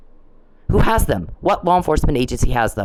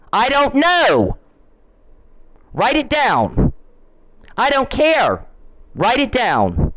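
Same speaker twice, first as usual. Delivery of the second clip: slow exasperated shout; 9 semitones higher, slower than usual. Real, not acted. Ranting at a harasser.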